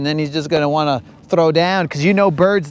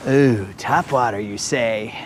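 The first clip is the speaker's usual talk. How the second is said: mock sly low; 7 semitones lower, 4 dB quieter than usual. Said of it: "I'm kind of like".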